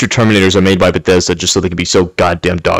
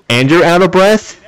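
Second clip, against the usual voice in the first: ordinary talk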